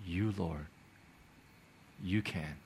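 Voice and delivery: very soft, low admission; hushed, low prayer of surrender; humbled surrender. Retold or imitated. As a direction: yield quietly, pausing between phrases. Retold humbled surrender to God.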